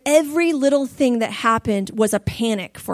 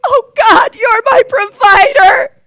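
loud, high, crying gratitude, slowing down; sobbing prayer of relief; tearful thanks; 10 semitones higher, 11 dB louder, slower than usual. Retold or imitated. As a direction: cry out thanks to God through tears.

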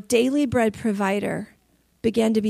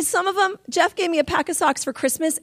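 usual speaking voice

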